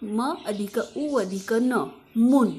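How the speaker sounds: ordinary delivery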